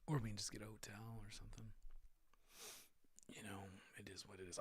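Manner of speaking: quick whispered aside, half to himself. Real, not acted. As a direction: whisper it off to the side, fast. Aside muttered away from mic.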